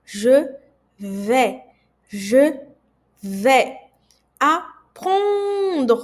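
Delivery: vais said as vè, punched loud; teacher stresses the vowel sound; French vowel demo. Shown as a demonstration. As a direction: hit the è in vais. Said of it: "A I tem som de E".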